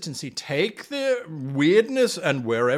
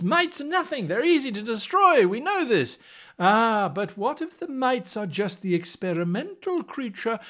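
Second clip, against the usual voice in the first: usual speaking voice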